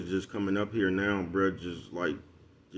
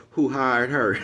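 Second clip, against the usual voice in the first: raised, louder mocking question; mocking jab; 4 semitones higher, 6 dB louder, much slower than usual. Mocking the female cop, a raised louder jab. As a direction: sneer it out as a put-down.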